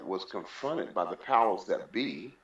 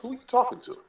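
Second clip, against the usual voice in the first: sharp, loud, much higher retort; indignant challenge; 6 dB louder, faster than usual. Retold or imitated. Confronting a yelling man at the weigh-in, high indignant challenge. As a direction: snap back at the man who yelled at you.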